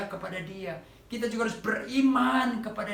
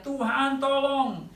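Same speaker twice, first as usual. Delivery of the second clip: higher, louder pleading shout; desperate cry for help; 6 semitones higher, 4 dB louder than usual. Retold or imitated. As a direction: beg the Lord loudly for mercy. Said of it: "dia berteriak".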